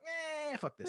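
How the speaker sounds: very high, whiny mock brush-off; comic high-pitched giving up; dismissive kid. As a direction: squeak it out like a fed-up child.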